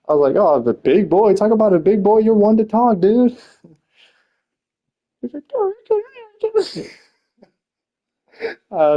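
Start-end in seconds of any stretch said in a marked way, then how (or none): 0.4-3.3 rising, higher mocking retort; retold roast. Teller replaying how he roasted Tim, mocking and higher-pitched. 5.5-6.9 squeaky high mock protest; imitated outrage. Tim fuming at the roast, imitated as a high squeal. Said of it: "He got so mad".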